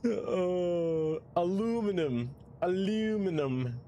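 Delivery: high, slow, drawn-out "aluminum"; high mock aluminum. Streamer teases the donation voice in a high, slow voice. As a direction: stretch it out high like a joke. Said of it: "say it the correct way".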